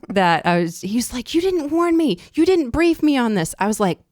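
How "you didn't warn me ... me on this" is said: high, louder indignant retelling; mock protest. Husband complaining to wife about no warning, mock-indignant. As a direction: mimic an indignant complaint, pitched up and loud.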